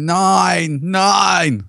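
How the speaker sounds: half-hearted scream for the joke's punchline; mock scream. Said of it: "She screamed".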